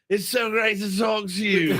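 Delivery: high, slurry put-on British accent; mock British rocker greeting. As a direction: imitate a tipsy Englishman, hammed up. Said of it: "like, [name] on me".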